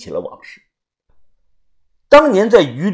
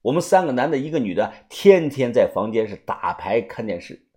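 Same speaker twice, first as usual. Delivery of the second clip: plain reading voice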